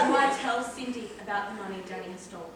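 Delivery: low, soft confessional murmur; hushed confession. Acted. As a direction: confide it quietly to the priest.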